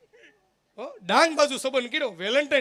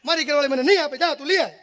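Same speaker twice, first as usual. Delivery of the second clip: much higher, loud protest; squabbling villagers; 7 semitones higher, 9 dB louder than usual. Retold or imitated. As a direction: squawk the refusal, high and loud. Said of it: "એક જણો કે".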